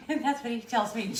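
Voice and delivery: words broken by laughter; laughing. Real, not acted. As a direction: giggle through it. Laughing after stumble.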